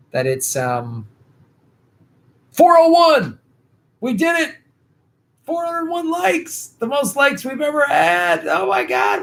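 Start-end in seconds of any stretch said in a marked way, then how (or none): 2.5-9.2 high-pitched thrilled squeal; excited squeal. Real, not acted. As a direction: shriek with joy at the milestone. Celebrating reaching 400 likes.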